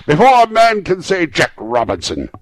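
high, loud, hearty command; rousing shout to hurry the lads; merry boss hollering orders; jovial cry. A narrator's reading. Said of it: "cried old Fezziwig".

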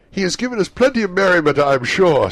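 put-on high, loud, fast cheerful young man; cheerful nephew. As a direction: toast with hearty good cheer. Fred voiced at the party.

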